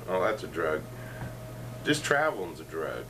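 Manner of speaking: ordinary talk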